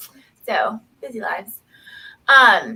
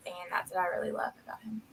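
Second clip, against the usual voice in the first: whispered; 4 semitones lower, 10 dB quieter, much faster than usual. Real, not acted. Tender remark about husband.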